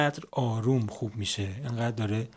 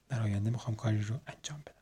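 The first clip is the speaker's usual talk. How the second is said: fast unvoiced whisper; tossed-off whispered aside; 8 dB quieter, much faster than usual. Real, not acted. Trailing aside.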